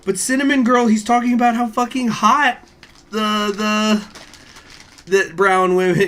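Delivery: high, loud excited retelling; realization rant. Retold or imitated. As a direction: spill it out like a sudden eureka. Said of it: "I went".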